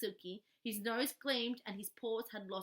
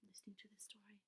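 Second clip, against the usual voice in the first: hushed whisper; 10 dB quieter, faster than usual. A narrator's reading. Hushed room.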